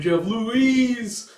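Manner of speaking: high, loud, slow announcer call; show title announcement. Acted. Closing the episode, slow high announcer call. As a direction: announce the title like a big reveal.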